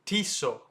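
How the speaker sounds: Tissot as TEE-so, silent T; long EE in Tissot; correct pronunciation. Shown as a demonstration. Teacher introduces the brand, correct pronunciation. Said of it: "when you say T".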